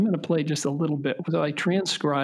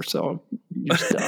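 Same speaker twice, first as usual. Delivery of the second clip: breathy chuckle through slow words; laughing; much slower than usual. Real, not acted. Joking dad aside to son.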